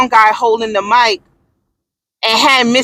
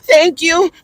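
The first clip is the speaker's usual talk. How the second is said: curt thank you; 4 semitones higher, slower than usual. Pretend. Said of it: "I would've went like this".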